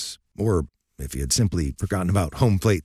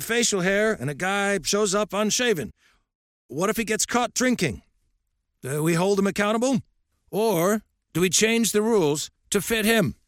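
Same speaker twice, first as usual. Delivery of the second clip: put-on lightly raised, gruff old coach lecturing; old coach's voice; 12 semitones higher than usual. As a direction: speak as a stern old baseball coach.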